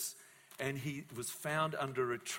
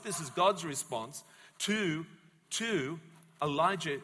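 usual speaking voice

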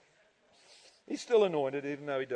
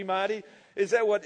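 normal talking voice

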